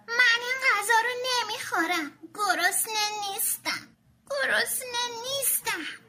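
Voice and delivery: shout. Acted. Tommy refusing his father's spaghetti, stubborn shout. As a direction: protest like a sulky kid at dinner. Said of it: "داد زد".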